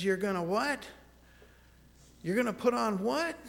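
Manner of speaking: can't believe what she just heard; baffled wife. Retold or imitated. Wife baffled by sleep talk.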